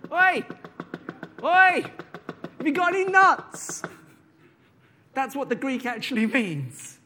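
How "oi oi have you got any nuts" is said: high, loud, slow squirrel calling; squirrel-like knocking. Shown as a demonstration. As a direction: demonstrate persistent squirrel asking. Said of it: "knock in a squirrel-like manner".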